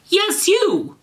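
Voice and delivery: high accusing cry; cried out. Acted. Draco accuses Garfield, high cry. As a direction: pitch it way up and jab the accusation. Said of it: "cried Draco".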